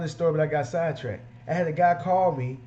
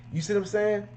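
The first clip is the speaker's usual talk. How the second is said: ordinary talk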